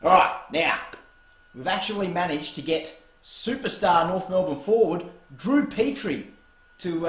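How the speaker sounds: ordinary talk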